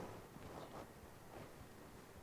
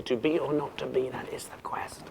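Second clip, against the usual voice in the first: fading to a whisper on "quest"; unable to finish; 7 dB quieter than usual. Acted. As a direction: let "that is the quest" die into breath, unfinished. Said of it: "But Hamlet can't say it".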